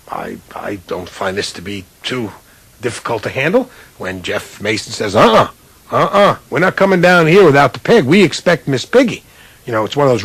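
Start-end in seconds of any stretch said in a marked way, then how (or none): 5.2-9.2 higher, firm no-nonsense demand; insistent refusal. Retold or imitated. Producer refusing the Disney executives, insistent. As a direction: dig in and refuse to budge.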